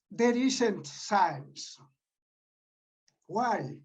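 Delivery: usual speaking voice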